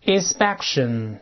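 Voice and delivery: inspection read slowly, syllable by syllable; slow model reading. Shown as a demonstration. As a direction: spell it out slowly for the class. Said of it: "跟老师大声读一遍".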